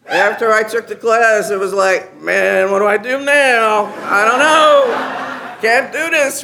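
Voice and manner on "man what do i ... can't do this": mock panic. Retold inner panic.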